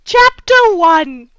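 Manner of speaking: loud, high, drawn-out chapter title; booming announcement. Acted. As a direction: announce the chapter with mock grandeur, slowly.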